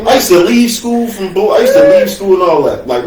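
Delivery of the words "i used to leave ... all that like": usual speaking voice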